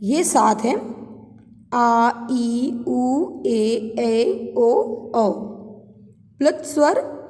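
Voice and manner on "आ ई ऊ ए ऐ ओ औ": आ, ई, उ, ए, ऐ, ओ, औ each held as a long vowel; teacher reciting आ, ई, उ, ए, ऐ, ओ, औ as separate long sounds. Shown as a demonstration. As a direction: sound out आ, ई, उ, ए, ऐ, ओ, औ one by one, each lengthened. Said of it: "थोड़ा अधिक समय लगता है".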